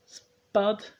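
high astonished gasp; high-pitched shocked question; Bob. A narrator's reading. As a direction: gasp the name in disbelief. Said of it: "gasped Bob".

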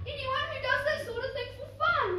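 put-on high-pitched, fretting girl's voice; dismayed thought. A narrator's reading. Cinderella doubting the head-chopping prince, high dismayed musing. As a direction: think it aloud, high and troubled. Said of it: "she thought".

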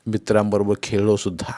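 ordinary delivery

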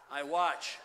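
loud, punchy answer; abrupt shouted declaration; sudden shout. Acted. Sudden loud answer to own question.

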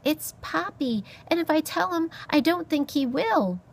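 high little mouse voice; timid mouse. Acted. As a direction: explain politely in a high mouse voice. Timid mouse corrects the beast.

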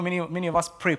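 normal talking voice

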